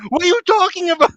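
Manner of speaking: very high incredulous cry; imitating someone utterly lost, voice shooting up; hammed-up squeaky confusion; mock bafflement. Acting out baffled reply to hero.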